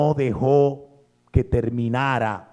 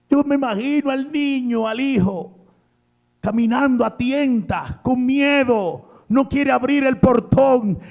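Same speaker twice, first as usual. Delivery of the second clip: high, ringing sermon voice; fervent preacher building the picture; impassioned preaching; 10 semitones higher, 5 dB louder than usual. Acted. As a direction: paint the scene for the congregation. Preacher imagines the scene.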